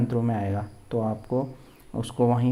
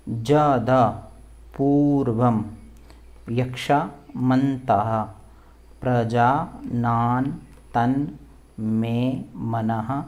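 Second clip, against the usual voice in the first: word-by-word demo. Shown as a demonstration. Teacher teaching the next mantra, one careful word at a time. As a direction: sound out each word for learners to copy. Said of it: "आपको इसी तरह से बोलना है".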